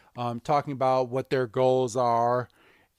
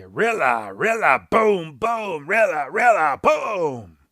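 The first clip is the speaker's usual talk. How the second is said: Rillaboom call; 2 semitones higher, 5 dB louder than usual. Retold or imitated. Recapping the episode for his brother, a loud Rillaboom chant. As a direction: belt out the Rillaboom call like a big drumming ape.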